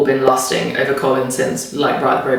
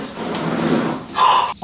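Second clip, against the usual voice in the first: sharp delighted gasp; surprised gasp; 4 dB quieter than usual. Real, not acted. Opening hidden drawer.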